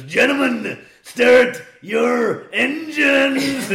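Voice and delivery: loud, high, drawn-out arena-style call; big booming call to the crowd; air raid announcement. Acted. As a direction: bellow it out slowly like a siren going off. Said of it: "an air raid siren goes off and it goes".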